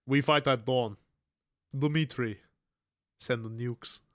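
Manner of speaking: put-on stern Russian accent. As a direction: give orders in a Russian accent. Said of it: "That's a decent Russian accent".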